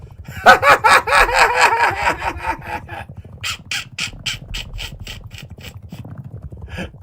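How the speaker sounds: long shrieking laugh; high loud cackle. Real, not acted. Host laughing hard at the race. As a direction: howl with laughter.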